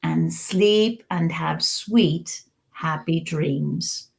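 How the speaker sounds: slow, low and soft story ending; lulling storybook close; soft bedtime reading. Acted. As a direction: wind the story down gently for sleepy kids.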